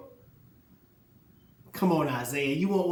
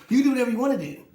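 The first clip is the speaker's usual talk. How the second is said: louder, higher burst; emphatic shout; 7 semitones higher, 8 dB louder, faster than usual. Real, not acted. Tells Jordan he can do anything, shouting. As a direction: shout it at him.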